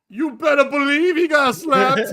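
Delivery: very loud, high imitated holler; yelling at screen. Lady reacts to onscreen slap, imitated gleeful yell. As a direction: holler it out like a riled-up moviegoer. Woman yells at movie screen.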